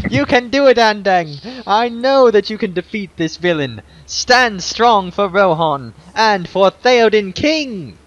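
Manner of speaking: put-on rally him like a hopeful young girl; encouraging girl. Girl cheers on the hero.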